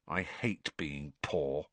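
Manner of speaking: gloomy. A narrator's reading. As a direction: let it drop out heavily, slowly. Ron glaring gloomily at his potato.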